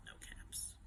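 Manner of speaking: hushed near-whisper; hushed discovery. A narrator's reading. Storyteller revealing the missing caps, hushed.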